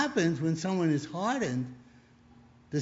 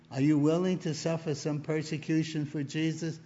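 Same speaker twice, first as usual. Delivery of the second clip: normal talking voice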